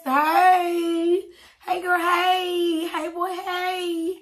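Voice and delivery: high, loud, stretched greeting; excited shout-out. Real, not acted. To her Texas viewers, a loud high excited shout-out. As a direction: call out hey to the fans, slow and high.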